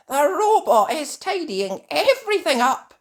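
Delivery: high, loud alarmed cry. A narrator's reading. Holly seeing the furniture outside, alarmed cry. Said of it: "cries Holly".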